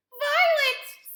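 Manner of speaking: high, loud scream of a name; mother screaming for her daughter. A narrator's reading. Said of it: "screamed Missus Beauregard".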